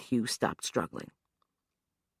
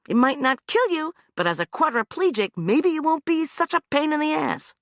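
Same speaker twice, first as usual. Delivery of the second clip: high, loud, mocking menace; sneering threat; 14 semitones higher, 5 dB louder than usual. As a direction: needle him with the threat.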